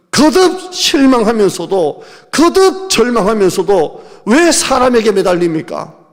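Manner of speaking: loud, slow, pleading sermon cry; fiery preaching. Acted. Pastor crying out to the congregation, loud and slow. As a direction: plead loudly and slowly.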